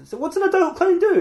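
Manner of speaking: very high-pitched, puzzled question; baffled question. Acted. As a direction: ask it in high, baffled disbelief. Baffled by adult clown entertainment.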